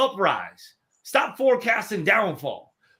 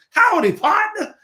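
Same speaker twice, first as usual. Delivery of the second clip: much higher and louder, hammed-up cowboy greeting; big cowboy howdy; 13 semitones higher, 6 dB louder than usual. Shown as a demonstration. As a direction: belt out the best howdy you can muster. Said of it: "Say the best daggone howdy".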